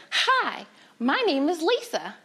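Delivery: put-on high, bouncy little-girl greeting; delightfully friendly girl. Girl on the camp bus introducing herself, friendly. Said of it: "this delightfully friendly girl".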